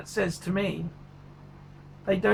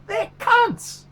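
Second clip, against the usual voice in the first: angry outburst; 12 semitones higher, 4 dB louder than usual. Real, not acted. Ranting about watch dealers.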